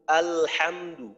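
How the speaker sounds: usual speaking voice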